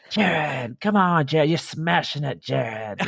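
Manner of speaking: mock British crowd cheer. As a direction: cheer him on like a fan on the course. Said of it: "But if you're like".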